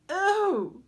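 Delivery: a sudden high cry of surprise; startled exclamation. A narrator's reading. Arachne startled by the old crone's arrival. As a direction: gasp it out, caught off guard. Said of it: "Arachne exclaimed".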